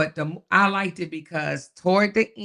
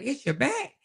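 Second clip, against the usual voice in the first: mock incredulous retort; 9 semitones higher, 4 dB quieter than usual. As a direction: pitch leaping up in disbelief. Acted reply to others' back complaints.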